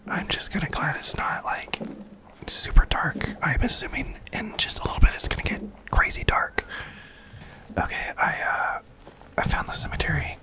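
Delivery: hushed game whisper; leaning in to whisper. Real, not acted. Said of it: "get my whisper voice on".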